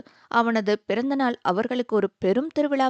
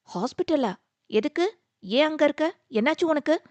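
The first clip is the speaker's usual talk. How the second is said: higher, rushed worried questions; anxious questions; 7 semitones higher than usual. A narrator's reading. Hearing he is in hospital, she asks anxiously. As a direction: fire off the questions in alarm. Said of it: "பதற்றமாக வினவ".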